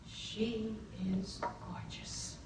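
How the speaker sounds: hushed whisper of admiration; awed whisper. Real, not acted. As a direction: breathe it out, stunned. Awed at seeing the captain.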